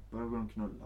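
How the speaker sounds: ordinary talk, nothing marked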